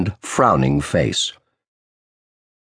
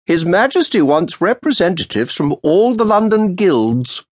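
put-on old official's sharp greeting; 11 semitones higher than usual. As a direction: snap out a cold greeting to the lawyer. Roland's voice.

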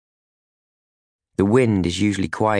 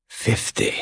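hushed command. Acted. The captor's count voiced again.

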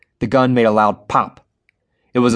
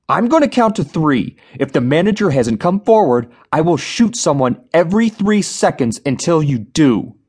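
threatening; 6 semitones higher than usual. A narrator's reading. Gunman threatening the crowd.

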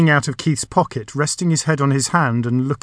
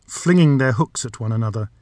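usual speaking voice

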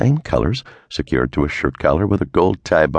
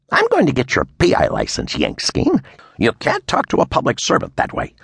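higher, huffy official threatening; indignant threat; 8 semitones higher than usual. A narrator's reading. Red-faced official threatening the detective, higher and huffy. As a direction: wag the finger with your voice.

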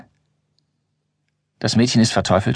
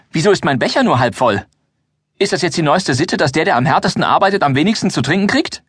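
higher, indignant complaint about the half-full cup; a worker griping that he gets the least to drink; grumbling miner; 6 semitones higher than usual. Acted. Bertil complains to the dwarfs about his half-empty cup, indignant. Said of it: "sagt Bertil".

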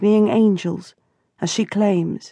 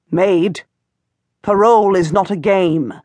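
interrupting reproof. A narrator's reading. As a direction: cut in to set her straight. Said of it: "Aunt Jehan interrupts".

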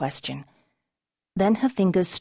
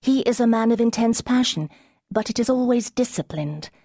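urgent; 7 semitones higher than usual. Acted. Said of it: "her voice urgent".

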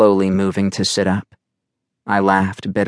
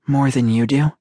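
ordinary delivery

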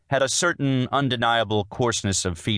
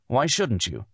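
mildly insistent, pitched up; demanding; 5 semitones higher than usual. A narrator's reading. Husband questions wife's guilt over their life, demanding. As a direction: press her for a reason. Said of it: "he demanded".

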